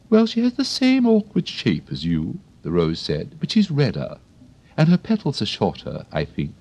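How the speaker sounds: high, prim flower voice; a proper lady of the garden; prim Rose. Acted. The Rose chats to Alice, high and prim.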